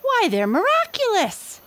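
very high squeaky delight; amazed monkeys. A narrator's reading. Six monkeys marvel at the glasses, very high and squeaky. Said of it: "said the six little monkeys".

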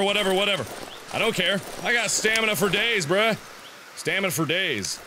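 ordinary delivery

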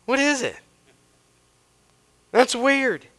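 high-pitched, baffled questioning; puzzled surprise. Acted. Israelites finding manna outside their tents, puzzled surprise. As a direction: act out baffled onlookers. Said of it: "How many times are we surprised".

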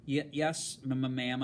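nervous stammer on yes and ma'am; frightened girl stammering a reply; stuttering. Acted. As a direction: trip over the first sounds fearfully. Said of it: "I stuttered".

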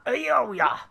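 high, playful drawn "oh yeah". Pretend. Player repeating the cheer, high playful "oh yeah". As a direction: swoop it up high. High second take of two.

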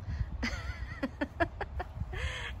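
high breathy giggle, nearly silent; helpless little giggle at the smiling eggs; giggling at egg faces. Real, not acted. Showing viewers funny face eggs, quiet giggle. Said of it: "they're making me laugh".

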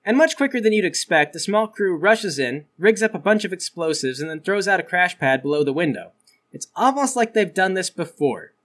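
usual speaking voice

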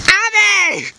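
high shouted name; calling out. Acted. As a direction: yell for her from a distance. Calling for a friend.

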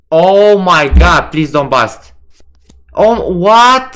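loud, high, slow begging; real desperate pleading; frantic pleading. Real, not acted. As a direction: beg the cards loudly. Tense blackjack hand.

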